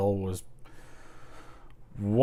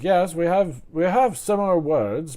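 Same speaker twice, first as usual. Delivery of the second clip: higher British comedian voice; Eddie Izzard; 7 semitones higher, 4 dB louder than usual. Acted. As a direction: announce it in a put-on British accent. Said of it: "he was like".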